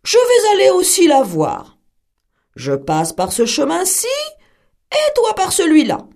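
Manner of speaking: put-on wolf daring the girl to race; challenging wolf. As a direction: throw down the challenge like a sly wolf.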